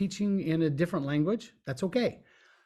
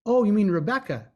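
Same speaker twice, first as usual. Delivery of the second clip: surprised realization; 5 semitones higher, 5 dB louder than usual. Retold or imitated. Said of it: "I'm like".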